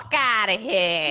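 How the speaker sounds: put-on slow, drawn-out New York accent; New York accent, the way everyone imitates it; aggressive New York accent. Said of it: "always in an aggressive manner".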